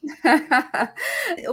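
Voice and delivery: a real laugh over the greetings; bright laugh. Real, not acted. Host laughing at the guest's greeting, real laugh. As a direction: laugh along warmly.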